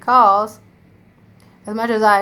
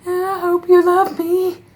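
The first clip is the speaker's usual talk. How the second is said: high, loud, slow mock-gushing sap; high, slow, overly sweet gush to viewers; gushing sappy voice; 10 semitones higher, 6 dB louder, slower than usual. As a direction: ham up the sappy fan-love sign-off. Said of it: "I'm always like".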